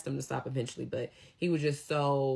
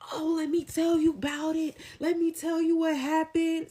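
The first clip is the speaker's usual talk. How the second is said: high, loud, far more excited voice for mom; eager mom gossiping; 14 semitones higher, 5 dB louder than usual. Retold or imitated. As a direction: play mom bursting to spill the story.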